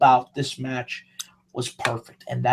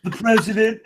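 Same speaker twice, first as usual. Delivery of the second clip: higher-pitched, puffed-up presidential impression; hammed-up political bragging; Donald Trump; 4 semitones higher than usual. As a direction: boast like a self-adoring president. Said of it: "[name] does Trump spot on".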